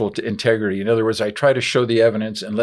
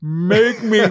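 high, tearful mock plea from a drunk friend, with a laugh; mock begging; 10 semitones higher, 4 dB louder, much slower than usual. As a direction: beg like a man who can't take one more drink. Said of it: "he's begging me with tears in his eyes".

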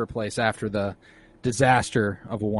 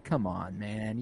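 ordinary talk, nothing marked

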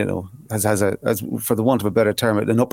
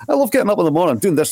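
higher-pitched demo Scottish accent; 6 semitones higher, faster than usual. Shown as a demonstration. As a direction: put on an enthusiastic Scottish accent. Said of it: "in a Scottish accent".

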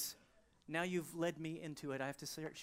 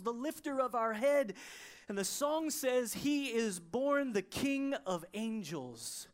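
loud, high, full-force preacher's proclamation; triumphant preaching; 8 semitones higher, 8 dB louder than usual. Real, not acted. Preacher proclaiming baby Jesus as King of Kings, triumphant preaching. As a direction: build each title like a victory cry.

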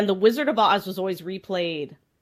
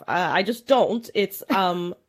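ordinary delivery

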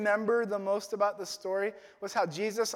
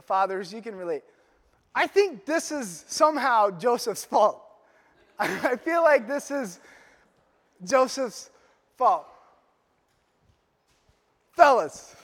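usual speaking voice

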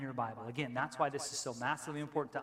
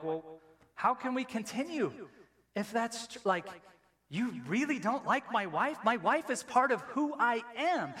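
hurt husband; 6 semitones higher than usual. Retold or imitated. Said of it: "I go like".

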